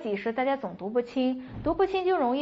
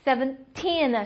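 put-on "seventeen" with a long, strong "teen"; stress on TEEN; slower than usual. Shown as a demonstration. Teacher drilling teen numbers, stress on the last syllable. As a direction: slowly, push the end of "seventeen". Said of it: "往后使劲儿啊".

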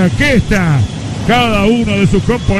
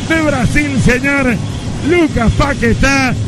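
triumphant call; 5 semitones higher, slower than usual. Real, not acted. Naming the scorer after goal.

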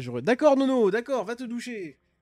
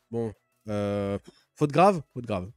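mock stern boss; 6 semitones lower, 4 dB louder, slower than usual. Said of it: "ils vont se dire".

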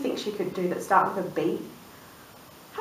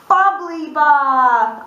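very slow, louder drawn-out reveal; delighted reveal; 6 semitones higher, 5 dB louder, much slower than usual. Acted. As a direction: savour the big surprise. Big reveal of wish.

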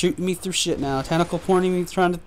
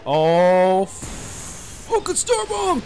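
exasperated wail; 2 semitones higher, much slower than usual. Real, not acted. Boss hits him with star bomb.

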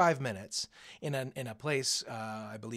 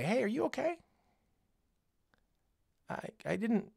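higher, caring question; gentle check-in; 9 semitones higher than usual. Retold or imitated. Teacher answering a rude kid, gently caring. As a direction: reach out kindly instead. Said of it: "and I say".

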